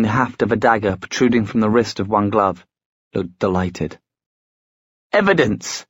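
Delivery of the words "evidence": high sharp snap; snapped. A narrator's reading. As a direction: bite the word off sharply. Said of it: "snapped Khalida".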